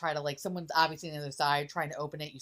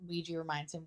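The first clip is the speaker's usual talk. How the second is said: ordinary talk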